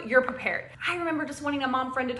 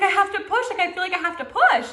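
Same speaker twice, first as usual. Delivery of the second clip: higher, louder, laughing urgency; urgent plea; 8 semitones higher, 5 dB louder than usual. Retold or imitated. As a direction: pitch up and push the words out urgently.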